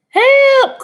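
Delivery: high, loud cry for help. A narrator's reading. Dish and Spoon crying out while falling. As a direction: yell from above.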